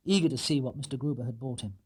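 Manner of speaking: ordinary delivery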